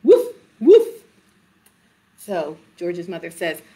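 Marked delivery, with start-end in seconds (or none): 0.0-1.0 loud, high woofs; demonstrating a dog's bark. Acted. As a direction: bark like a dog, loud and short. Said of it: "Dogs say".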